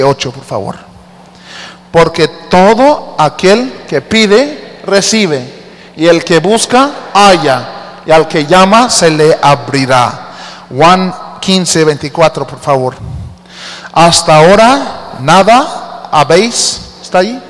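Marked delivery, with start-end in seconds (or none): 1.9-10.3 slow, measured Bible reading; scripture reading. Acted. Reads scripture aloud. 13.9-16.8 slow, measured Bible reading; scripture reading. Acted.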